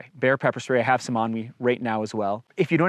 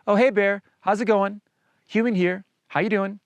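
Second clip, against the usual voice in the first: cheery, easygoing hello pitched much higher; friendly casual greeting; 7 semitones higher than usual. Shown as a demonstration. Showing hikers the right way to greet a bear, friendly and casual. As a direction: greet the bear like an old buddy. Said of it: "but more just say".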